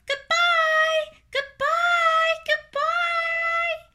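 very high, loud little squeals; piercingly high singsong farewells; tiny farewell cries. A narrator's reading. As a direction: call out in a tiny creature voice, drawn out. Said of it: "came weakly to Wilbur's ears".

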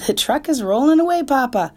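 high, loud alarmed call. Acted. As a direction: warn Papa urgently. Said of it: "said Fern".

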